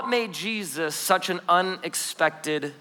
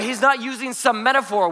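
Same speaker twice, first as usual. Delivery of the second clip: normal talking voice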